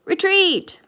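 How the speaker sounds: high, loud startled captain's cry; high-pitched yelp of command; cried out. A narrator's reading. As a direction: shout the retreat in a panic. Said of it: "the captain cried".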